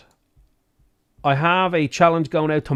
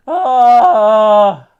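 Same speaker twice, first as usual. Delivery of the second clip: long, loud high yell; drawn-out roar of exasperation; frustrated scream; 7 semitones higher, 11 dB louder than usual. Real, not acted.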